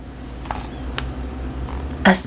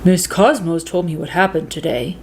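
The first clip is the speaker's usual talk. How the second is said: dad voice; 3 semitones lower than usual. A narrator's reading. Dad speaks after school.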